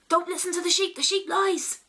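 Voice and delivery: farmer yells. Retold or imitated. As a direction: shout the warning across the farmyard. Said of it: "suddenly the farmer yells".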